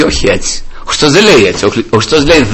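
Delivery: ordinary delivery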